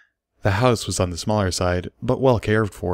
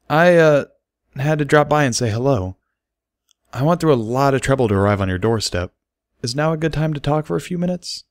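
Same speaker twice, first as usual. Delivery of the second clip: put-on higher, halting young stallion; awkward Bucky; 7 semitones higher, 3 dB louder than usual. A narrator's reading. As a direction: stumble through the visit awkwardly. Bucky voiced at the door.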